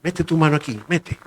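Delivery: Jesus gently inviting Thomas; merciful, gentle. Retold or imitated. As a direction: coax him gently to touch. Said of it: "tuvo misericordia de él y dijo".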